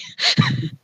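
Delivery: very high squealing laugh; shrieking laugh. Real, not acted. Cohost laughs at her own joke with a shriek. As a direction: let out a shrill burst of laughter.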